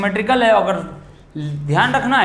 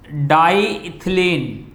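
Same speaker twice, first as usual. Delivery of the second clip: slow spelled-out name; much slower than usual. Shown as a demonstration. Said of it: "naming समझो".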